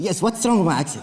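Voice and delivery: Korean-accented English, mock offended. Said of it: "with this accent?".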